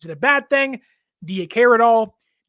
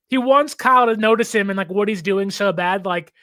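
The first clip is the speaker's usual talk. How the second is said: usual speaking voice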